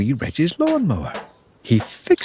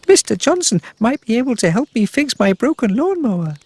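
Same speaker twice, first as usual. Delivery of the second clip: puppy voice; 12 semitones higher, 3 dB louder, faster than usual. A narrator's reading. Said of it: "thought Wee Reg".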